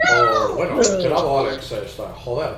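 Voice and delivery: mock Spaniard holding a beer; Spain Spanish accent. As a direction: lisp it like a guy from Spain. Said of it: "how the people from Spain talk".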